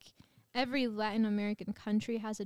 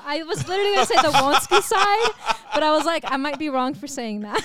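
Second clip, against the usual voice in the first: louder, laughing while she admits it; laughing through it; 6 semitones higher, 14 dB louder, faster than usual. Real, not acted. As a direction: giggle through the confession. Laughing confession about Walensky side.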